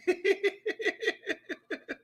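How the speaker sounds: high, giggly laugh; amused laugh. Real, not acted. Amused by his own bold predictions.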